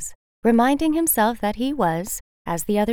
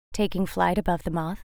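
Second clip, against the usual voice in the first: ordinary delivery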